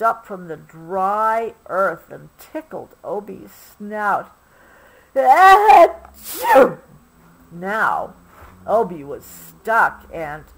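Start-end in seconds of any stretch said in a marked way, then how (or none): 5.2-6.8 high loud sneeze. A narrator's reading. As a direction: sneeze hard. Dust tickles snout.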